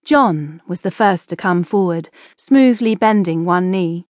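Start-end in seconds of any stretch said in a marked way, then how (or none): none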